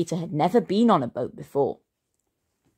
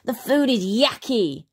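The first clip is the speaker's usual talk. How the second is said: grumbling Henry; 6 semitones higher than usual. A narrator's reading. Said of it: "Henry grumbled".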